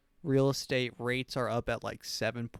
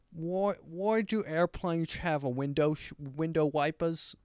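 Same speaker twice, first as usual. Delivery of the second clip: higher, dopey mock-British voice; dim British accent; 4 semitones higher than usual. Dumb Brit asking about airplanes, dim British accent. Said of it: "Just a Mongoloid British person".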